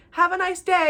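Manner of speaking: high forced-polite sendoff; sugary fake politeness. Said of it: "And I said".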